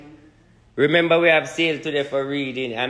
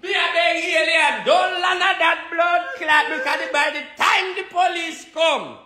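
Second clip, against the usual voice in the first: mock pleading shout; 13 semitones higher, slower than usual. Begging aliens not to land in Jamaica, hammed-up high shout. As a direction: beg at the top of your voice.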